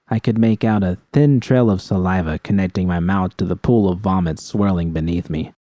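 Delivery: plain reading voice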